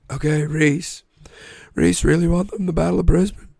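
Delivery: mock American politician praise; Donald Trump. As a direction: declare it like an American politician at a rally. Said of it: "why do I sound like Donald Trump?".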